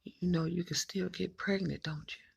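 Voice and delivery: a grave, soft, near-whispered reminder; serious hushed warning. Retold or imitated. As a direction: say it dead serious and low. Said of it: "she said, so serious".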